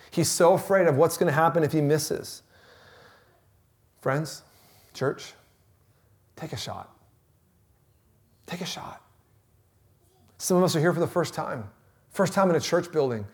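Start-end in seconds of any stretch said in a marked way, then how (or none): 4.0-6.9 soft urging. Acted. Urging the church softly. 8.4-9.0 breathy whisper, no voice; whispered urging. Acted. Preacher whispering to the church to take a shot.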